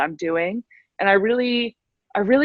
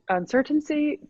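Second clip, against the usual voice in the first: uncertainty lifted high in a sing-song; uncertainty, pitched up playfully; sing-song repeat; 8 semitones higher, 4 dB quieter than usual. Shown as a demonstration. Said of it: "again, a lot of uncertainty".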